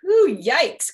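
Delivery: loud high yikes; Froggy grossed out by the diaper; disgusted cry. Acted. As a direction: recoil at the smell. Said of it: "cried Froggy".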